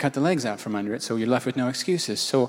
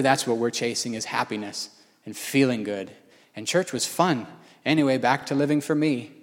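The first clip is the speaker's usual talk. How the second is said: usual speaking voice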